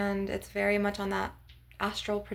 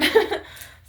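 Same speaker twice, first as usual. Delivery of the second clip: short airy high giggle; laugh; 12 semitones higher, 8 dB louder than usual. Real, not acted. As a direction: burst out in a breathy little laugh. Laughing at her own quirk.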